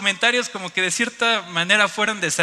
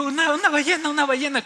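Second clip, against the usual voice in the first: high, louder insistent repetition; excited explaining; 9 semitones higher, 4 dB louder than usual. Retold or imitated. Tourist explaining the whale to foreigners, excited. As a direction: blurt it out excitedly.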